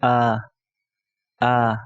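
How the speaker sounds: "a" as a long, open vowel; alif madda sound. Shown as a demonstration. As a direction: hold "a" as a sustained open vowel. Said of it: "the sound of alif madda is".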